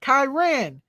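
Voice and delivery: ordinary delivery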